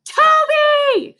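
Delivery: loud, high calling shout. Acted. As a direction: yell after a runaway dog. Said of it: "she shouted".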